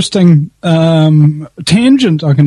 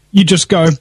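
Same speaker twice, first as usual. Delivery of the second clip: ordinary delivery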